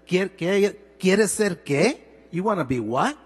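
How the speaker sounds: a retold father doubting his son, mildly challenging. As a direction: challenge the boy's dream with disbelief. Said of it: "in a very challenging tone".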